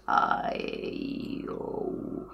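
creaky, very low vowels; vocal fry vowels. Shown as a demonstration. Singing teacher demonstrating vowels in vocal fry. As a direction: crackle through each vowel. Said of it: "con el vocal fry... todas las vocales".

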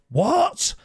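very high mimicked call of not hearing; shouting old boy. Delivery man at the doorbell, imitated high old-boy shout. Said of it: "He's shouting like, like he's an old boy".

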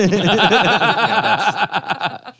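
high, loud cartoon cackle; maniacal laugh. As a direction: cackle like a crazed cartoon character. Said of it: "Do the noise".

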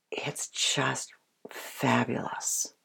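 slow soft whisper; whispered awe. Real, not acted. Trainer whispering in wonder about the bond with the dog. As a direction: breathe it in wonder. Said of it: "soft closing".

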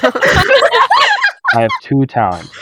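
slow, high, laughing through it; laughing, drawn out. Real, not acted. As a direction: stretch it out while laughing. Repeats announcement laughing, drawn out.

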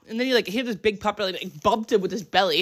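ordinary talk, nothing marked

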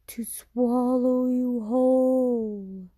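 creature voice. Acted. Creature answers the boy.

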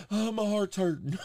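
hammed-up motherly distress; distressed mom. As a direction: imitate mom fretting over her heart. Imitates panicked mom.

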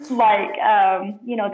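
ordinary talk

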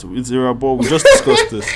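amused, exasperated correction; laughing exasperation. Real, not acted. Host correcting the wrong surname yet again, laughing exasperation. Mock exasperation over repeated name mistake.

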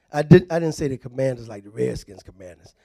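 low, soft, quick throwaway; offhand joking aside. Real, not acted. Preacher joking with congregation about the Commanders, offhand. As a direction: drop low and toss it off fast.

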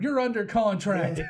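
high, loud, drawn-out retort; mock outraged shout. Co-host plays catching a quitter, loud mock indignation. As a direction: yell it slowly like a comic boss.

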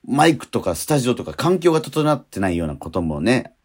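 usual speaking voice

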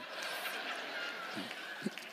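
quiet unvoiced laugh; soft wheezing laugh; breathy laugh. Real, not acted. Laughs at young roaches joke.

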